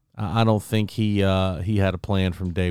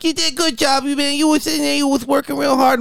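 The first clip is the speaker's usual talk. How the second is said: Mike Tyson impression. Tyson consoling his beaten opponent, in a high mock voice. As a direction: imitate Tyson's high lisping praise. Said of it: "Mike Tyson's like".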